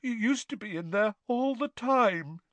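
put-on sulky miserable girl. A narrator's reading. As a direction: whine it miserably. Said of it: "said Myrtle miserably".

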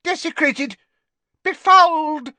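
high, loud, slowed outraged cry; outraged librarian. A narrator's reading. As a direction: shriek it as a horrified old librarian. Madame Pince outrage.

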